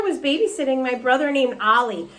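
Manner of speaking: usual speaking voice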